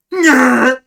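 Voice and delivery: a loud stretched yell. Retold or imitated. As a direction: belt it out of nowhere. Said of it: "Minute goes by".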